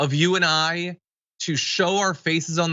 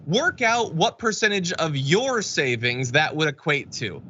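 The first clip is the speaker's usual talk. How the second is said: ordinary delivery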